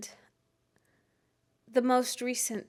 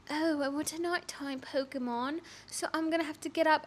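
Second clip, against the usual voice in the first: higher, softer put-on British accent; mock British; 5 semitones higher, 4 dB quieter than usual. Acting out a player with leisure for night Pokemon, mock British. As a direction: play it with an affected British lilt. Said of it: "I don't know why I turned British".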